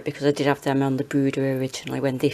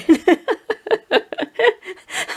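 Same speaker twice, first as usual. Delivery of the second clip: high giggling laugh; 7 dB louder than usual. Real, not acted. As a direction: burst out laughing. Laughing at son's tip.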